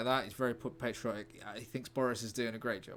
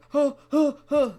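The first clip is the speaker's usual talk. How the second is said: high loud panting gasps, hammed up; mock dying breaths; pathetic gasps; 14 semitones higher, 13 dB louder than usual. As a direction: gasp weakly like a dying man, overplayed. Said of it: "like, pathetic".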